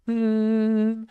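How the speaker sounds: held high hum; humming demo. Shown as a demonstration. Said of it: "get your voice into this, like".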